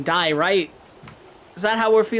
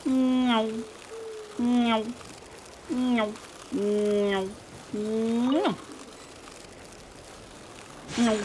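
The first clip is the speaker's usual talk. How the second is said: higher cat-like meows; playful meowing; 5 semitones higher, 4 dB quieter, much slower than usual. Pretend. Streamer meowing at a cat character, light playful meows.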